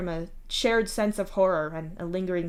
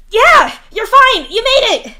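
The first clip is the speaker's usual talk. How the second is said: much higher, loud, fake-happy insisting; forced bright cheer; 15 dB louder, slower than usual. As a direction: paste on a smile and shout it. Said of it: "a bright smile plastered on his face".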